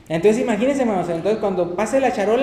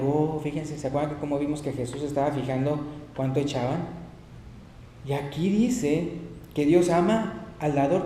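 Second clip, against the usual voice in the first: normal talking voice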